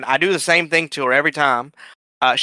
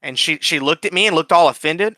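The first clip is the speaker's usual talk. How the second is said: usual speaking voice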